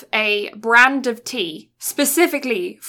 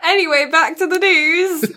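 louder and higher, cracking up; laughing; 8 semitones higher, 6 dB louder than usual. Real, not acted. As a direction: try to get back on track but keep laughing. Laughing after the joke.

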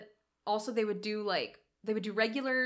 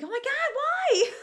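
laughing exclamation; 5 dB louder than usual. Retold or imitated. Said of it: "And I'd be like".

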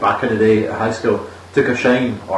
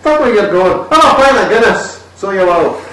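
high, louder hammed-up Irish brogue; comedy Irish accent; 7 semitones higher, 4 dB louder than usual. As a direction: ham up a stage Irish accent. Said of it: "I wish you sounded more Scottish".

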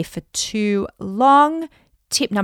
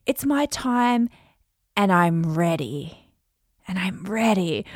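slow, softer "my time", repeat "and I'm ready"; stress on my; 3 dB quieter than usual. Shown as a demonstration. Coach modelling a slow, softer take of the affirmation. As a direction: lean gently on "my" and let "ready" fall. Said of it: "you can emphasize different words".

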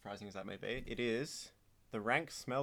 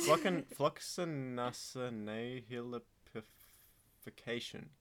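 slow careful pronunciation; 5 dB louder, much slower than usual. Shown as a demonstration. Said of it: "had to pronounce floccinaucilification".

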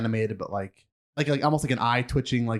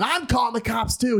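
high-pitched imitation of an irate man; worked-up, higher-pitched retort; mock indignant; 10 semitones higher than usual. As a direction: throw the threat back in a mock outraged voice. Imitating irate man threatening police.

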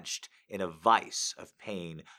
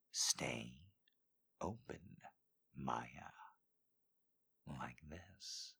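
low, much softer instruction; hushed command; 6 semitones lower, 13 dB quieter, much slower than usual. Acted. Dean telling Maya to stay open, hushed and low. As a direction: say it quietly, close to her.

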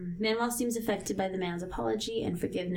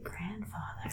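hushed, questioning mock whisper; imitating a puzzled man in a whisper; incredulous quote; 11 dB quieter, slower than usual. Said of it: "Chan Sung is like".

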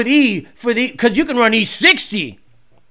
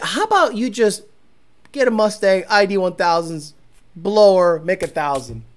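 ordinary talk, nothing marked

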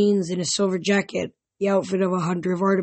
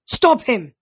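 a sharp raised call to stop him; shouted command to stop a ghost; urgent shout; 4 semitones higher, 7 dB louder than usual. A narrator's reading. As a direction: bark the order, urgent. Nico halting a ghost drinking.

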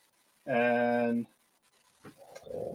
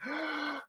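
sharp high excited gasp; 12 semitones higher, 12 dB quieter than usual. Retold or imitated. Mom gasping at the news, excited gasp. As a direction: gasp of delight.

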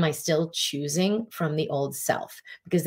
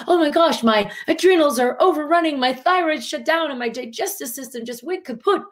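panicked rush; 9 semitones higher than usual. Acted. Coach mimicking a panicked patient listing symptoms, high alarmed voice. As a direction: blurt the symptoms like a frightened patient.

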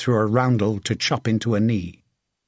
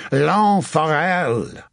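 slow, raised, long-held cry; war-cry shout; 4 semitones higher, slower than usual. A narrator's reading. French knight announcing himself to his English foes, shouting. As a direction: call the name out across the battle. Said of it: "he shouted".

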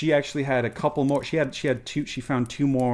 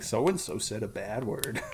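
mock filter warning; 5 dB quieter than usual. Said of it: "red flag about, like".

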